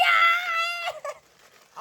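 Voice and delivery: high cheering yell; excited yell. Real, not acted. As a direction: let out a delighted cry.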